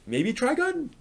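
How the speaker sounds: high, hesitant question; a lifted, unsure "maybe"; tentative suggestion. Retold or imitated. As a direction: float it as a shy offer. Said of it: "I'm like".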